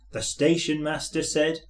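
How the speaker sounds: ordinary delivery, nothing marked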